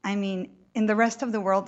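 ordinary delivery

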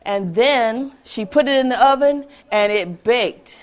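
ordinary delivery

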